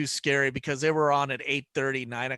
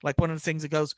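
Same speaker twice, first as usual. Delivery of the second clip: ordinary delivery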